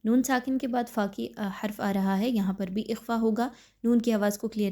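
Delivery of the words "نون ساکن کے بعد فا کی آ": normal talking voice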